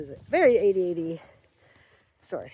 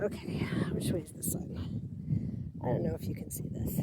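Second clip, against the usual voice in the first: hushed whisper to the camera; 3 semitones lower, 8 dB quieter than usual. Real, not acted. Alone by the frozen pond, whispering to the camera. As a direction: whisper to herself while finding the sun. Unvoiced low lines, no reason given.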